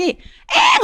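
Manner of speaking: high-pitched mock wail of a crying daughter; imitated child's cry. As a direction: imitate your little girl bursting into tears. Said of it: "하고 울더라고요". (딸아이 울음소리를 높게 흉내 내며)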